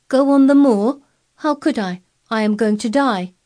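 high, loud startled cry; startled outburst. A narrator's reading. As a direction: blurt it out, shocked. Startled boy cries out.

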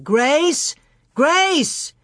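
high calling shout. A narrator's reading. Pa searching for missing Grace, high shout. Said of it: "he shouted".

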